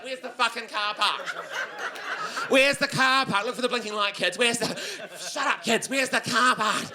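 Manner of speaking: lightly voiced frantic mum hunting for the car; a hint of a harried mum snapping at kids; stressed mall mum. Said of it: "like a stressed out mum at the mall".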